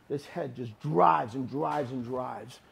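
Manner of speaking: each drives dragged out slowly; slow emphatic. Shown as a demonstration. Coach stressing head pressure, slow heavy emphasis. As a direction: lean on every drives, very slow.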